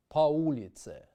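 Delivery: по улице with по said like pa; reduced unstressed o. Shown as a demonstration. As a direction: say по as pa before улице. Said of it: "Notice how we transliterate".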